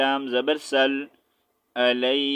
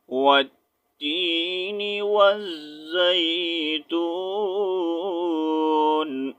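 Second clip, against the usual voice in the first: Quran recitation; 3 semitones higher, much slower than usual. Shown as a demonstration. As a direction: chant the whole surah slowly, each verse drawn out. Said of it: "پڑھ کر سنا دیتا ہوں".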